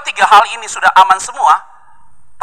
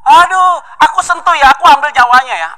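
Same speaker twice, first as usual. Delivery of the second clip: pleading devil; 9 semitones higher, 5 dB louder than usual. Acted. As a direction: coax God like a sly devil. Devil begging God in story. (iblis merengek memohon izin, suara tinggi dan pelan)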